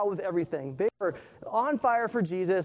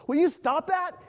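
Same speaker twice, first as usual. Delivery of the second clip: pitched-up exasperated plea; exasperated girlfriend; 8 semitones higher, 5 dB louder than usual. Retold or imitated. Girlfriend pleads with her backseat-driving date, pitched-up and exasperated. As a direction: make her fed up. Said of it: "she goes".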